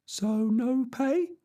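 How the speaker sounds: peasant voice. Acted. Sancho disappointed.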